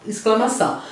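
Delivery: ordinary talk, nothing marked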